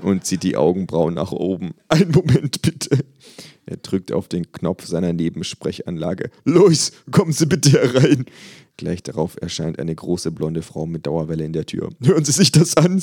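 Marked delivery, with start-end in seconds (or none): 1.9-3.0 much higher, louder, slow amused doctor; incredulous psychiatrist. Acted. Psychiatrist voiced in story. 6.4-8.3 much higher, louder, slow amused doctor; incredulous psychiatrist. Acted. Psychiatrist voiced in story. 12.0-13.0 incredulous psychiatrist. Acted. Psychiatrist voiced in story.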